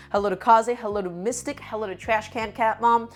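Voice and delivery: ordinary delivery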